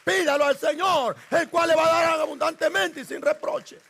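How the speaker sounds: fiery pulpit preaching. Acted. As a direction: preach it out to the congregation with force. Preacher exhorting the church.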